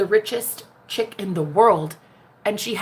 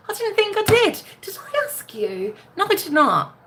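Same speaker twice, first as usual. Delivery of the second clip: put-on British accent; 3 semitones higher than usual. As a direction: snap back in a mock London accent. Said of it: "What's with the accent switch".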